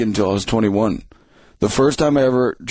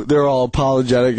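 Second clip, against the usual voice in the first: ordinary talk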